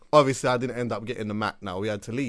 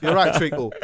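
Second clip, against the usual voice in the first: laughing, higher, hammed-up English accent; 7 semitones higher, 7 dB louder than usual. Flirting with a stripper in New York, in a hammed-up English accent. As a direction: lay the English accent on thick while giggling. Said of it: "I'm using my English accent to the T now".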